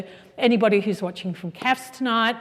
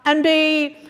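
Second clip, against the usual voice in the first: grand showbiz host voice, high and loud; breathy vintage purr; 8 semitones higher, 4 dB louder than usual. Acted. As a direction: introduce the star like an old-time host. Host introduces guest grandly.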